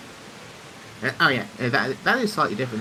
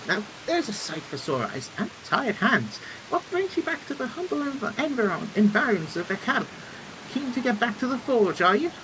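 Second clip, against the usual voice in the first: higher, theatrical engineer voice; hearty engineer's greeting; genial engineer character; 11 semitones higher than usual. Acted. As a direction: play a chatty inventor welcoming a colleague.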